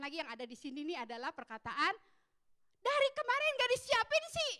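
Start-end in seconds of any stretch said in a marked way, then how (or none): 2.8-4.5 high, loud scolding nag; a mother blaming her son for his carelessness, high and nagging; nagging reproach. Retold or imitated. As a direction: pile on the blame like an irritated mom. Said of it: "pengen ngomong begitu".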